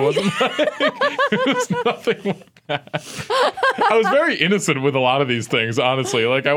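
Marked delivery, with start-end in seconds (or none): 0.6-2.9 high, slow words through a laugh. Real, not acted. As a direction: laugh it off in embarrassment. Embarrassed denial.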